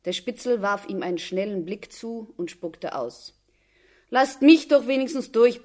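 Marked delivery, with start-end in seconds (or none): 4.1-5.6 loud, pleading call to the guards. A narrator's reading. Mother begging the guards to let her pass, loud plea. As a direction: beg to be let through. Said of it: "bat die Mutter".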